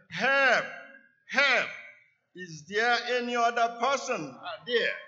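high, loud panicked yell; yelling for help. A narrator's reading. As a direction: scream for anyone else. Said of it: "Jack yelled".

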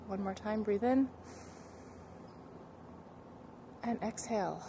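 calm breathing guide voice; guided breathing. Acted. Leads breathing warm-up.

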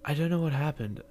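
put-on mild tearful kid voice; crying apology. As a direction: sob out the apology. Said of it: "I began to cry".

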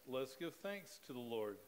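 normal talking voice

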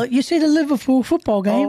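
put-on British football chat; British accent. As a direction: ask as a mock Liverpool fan with a British accent. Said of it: "it has to be British now".

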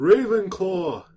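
slow, high, loud proclamation; booming announcement. Acted. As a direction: call the house out grandly. Sorting Hat announces house, drum roll.

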